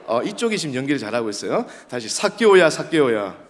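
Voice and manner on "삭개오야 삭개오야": loud call that drops on the repeat; acting as Jesus. Shown as a demonstration. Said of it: "한번 연기를 한번 해봅시다".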